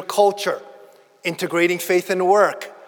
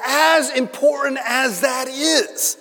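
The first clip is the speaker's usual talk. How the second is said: emphatic shout; 7 semitones higher, slower than usual. Real, not acted. Preacher to congregation, rising slow shout. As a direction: drive the point home slowly.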